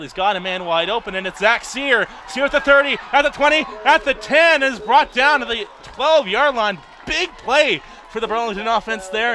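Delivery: rising shout as the run breaks; excited play-by-play. Real, not acted. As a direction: yell the play as it happens. Big play called live.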